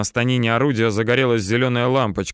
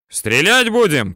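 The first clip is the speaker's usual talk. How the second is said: raised, higher questioning call; shouted question; 5 semitones higher, 4 dB louder than usual. A narrator's reading. Gunner asking commander whether they will fire, shouted question. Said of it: "кричит наводчик".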